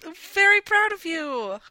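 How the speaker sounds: high, slow, sugary condescension; patronizing. Pretend. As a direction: talk down like to a child. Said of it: "hear the pat on my head".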